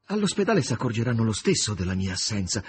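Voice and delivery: plain reading voice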